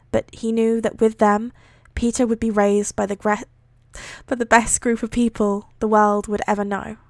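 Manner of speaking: projected, measured read-aloud; story narration. A narrator's reading. Reading a fan fiction aloud, measured narration. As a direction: read the story out clearly, voicing dialogue lightly.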